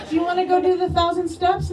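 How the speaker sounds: mock-eager invitation. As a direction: imitate a pushy friend pitching exercise. Friends' pushy invitation, retold.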